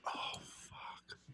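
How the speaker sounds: a stunned little whisper under the breath; whispered oath. Retold or imitated. Reacting to his own surprise solo, in a whisper. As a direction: mouth it almost silently in awe. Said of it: "And I was like".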